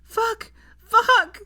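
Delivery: losing all control; panicked screaming. A narrator's reading. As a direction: scream it, the last one loudest. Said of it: "she began to panic".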